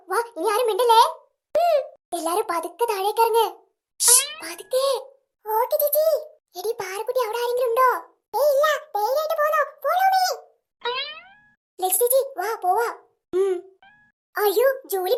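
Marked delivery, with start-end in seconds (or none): none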